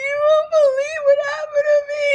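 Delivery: high, fast, squeaky wail; hammed-up tearful squeal through laughter; mock crying. Guest runs crying to Libby, comically high wailing. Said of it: "I'm crying".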